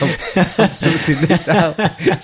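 slow mock-determined, laughing through it. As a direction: drag it out while laughing. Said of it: "repeated slower, laughing".

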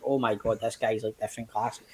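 quietly stunned at the opponent; awed inner thought. Retold or imitated. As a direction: drop away as if thinking it to yourself. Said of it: "I'm like".

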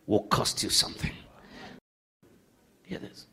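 usual speaking voice

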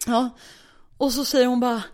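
ordinary delivery

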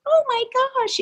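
squeaky-high imitation of a younger self. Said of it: "talking in a very high voice".

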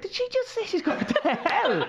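high, slow incredulous question through laughter; retold astonishment, pitch shooting up; laughing disbelief. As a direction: squeal it in mock shock, cracking up. Disbelieving brothers, retold while laughing.

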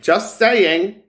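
high, loud sing-song just saying; a mock-innocent high "Just saying"; teasing.

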